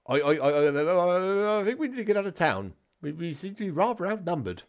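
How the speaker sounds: high, fast, stammering panic; nervous weird man. Acted. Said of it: "this weird man".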